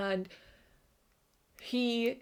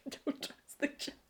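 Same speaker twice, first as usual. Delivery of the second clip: suspicious whisper; 6 semitones higher, 5 dB quieter than usual. Retold or imitated. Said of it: "I'm like".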